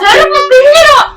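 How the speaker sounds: high, loud and slow menace; furious threat. Acted. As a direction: spit the threat out slowly. Wife threatens husband. (கோபமான மிரட்டல்)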